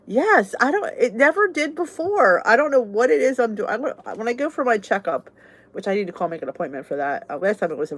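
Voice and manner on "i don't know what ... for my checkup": ordinary delivery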